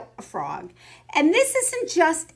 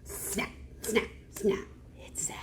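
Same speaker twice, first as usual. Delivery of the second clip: suspenseful whisper; 3 semitones lower, 11 dB quieter than usual. Acted. Crocodile suspense.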